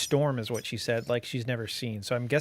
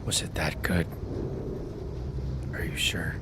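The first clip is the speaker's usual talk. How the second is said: much lower teasing put-on voice; deep put-on voice; 8 semitones lower, 3 dB quieter than usual. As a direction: drop way low, mock-serious. Repeats in put-on voice.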